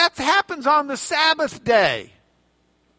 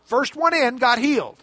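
usual speaking voice

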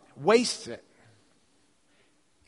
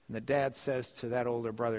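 usual speaking voice

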